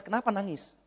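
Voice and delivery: ordinary talk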